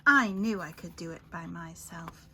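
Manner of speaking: usual speaking voice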